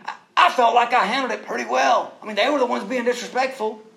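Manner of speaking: high, loud mock outrage; hammed-up protest at being blamed; indignant self-defense. Husband insisting to wife he handled it well, mock indignant. Acted argument, blaming the sons.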